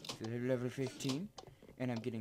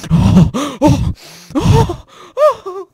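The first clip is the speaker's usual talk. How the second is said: much higher, much louder mock outburst; hammed-up oh cries; 29 dB louder, much slower than usual. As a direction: wail the ohs over the top. Exaggerated reaction shout.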